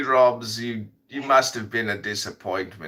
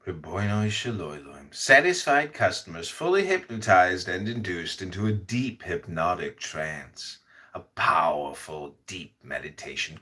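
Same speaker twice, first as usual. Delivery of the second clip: mock hypnotist ad; 2 semitones lower, 4 dB quieter than usual. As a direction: sell the trance like a late-night ad.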